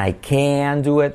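slow, with CAN drawn out as "keh-an"; said slowly. Shown as a demonstration. Teacher showing the slow version, drawn-out. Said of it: "dicho despacio".

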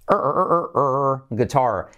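"Er, er" repeated as a long American R before "guitar"; exaggerated rhotic "er" practice; drilled R. Shown as a demonstration. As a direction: drill the R sound, then land on "guitar". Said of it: "practicar la R letra clave al final".

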